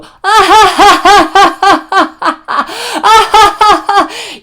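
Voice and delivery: loud high maniacal laugh; unhinged public cackle; manic cackle. Shown as a demonstration. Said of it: "you know how they go".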